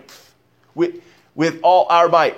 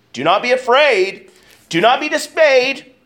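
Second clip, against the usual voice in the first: each line climbs higher; impassioned, higher each time; rising exhortation; 8 semitones higher than usual. Real, not acted. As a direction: urge them on with growing fire.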